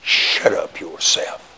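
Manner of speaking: muttered, slow and low under the breath. Retold or imitated. Husband silently cursing his wife, slow low mutter. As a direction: grumble it to yourself unheard. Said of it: "You think".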